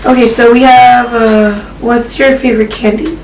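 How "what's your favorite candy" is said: ordinary talk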